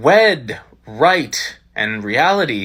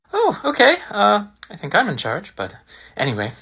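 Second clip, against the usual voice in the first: normal talking voice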